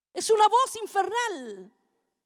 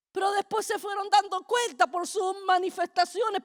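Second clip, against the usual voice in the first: normal talking voice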